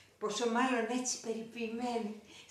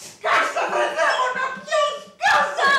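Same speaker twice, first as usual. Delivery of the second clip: very high, loud shriek breaking into laughter; shrieking half-laughing fury; hysterical shriek; 9 dB louder, slower than usual. Acted. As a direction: scream at her to shut up. Screaming at crying child.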